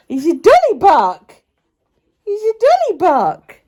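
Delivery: high, slow cooing. Real, not acted. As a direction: tease the barking dog sweetly. Cooing at barking dog.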